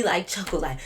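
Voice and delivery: ordinary delivery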